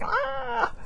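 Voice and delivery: high, drawn-out "ah"; imitated high wail of frazzled energy; frazzled energy noise. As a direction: voice your scattered energy as a high "ah". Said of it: "my energy is just like".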